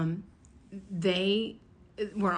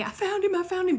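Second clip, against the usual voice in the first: excited; 10 semitones higher, 4 dB louder than usual. Retold or imitated. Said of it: "call my sister and say".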